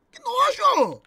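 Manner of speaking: high, loud "que nojo!"; disgusted outcry. Real, not acted. Disgusted by the friend's question, crying out loud and high. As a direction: recoil in high-pitched disgust.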